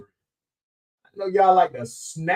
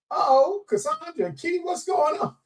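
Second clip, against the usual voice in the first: excited greeting; 11 semitones higher than usual. Real, not acted. Greeting a viewer joining the show.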